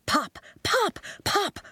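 playful popping noises; popping sounds. A narrator's reading. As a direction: pop each word like a bubble.

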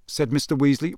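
plain reading voice

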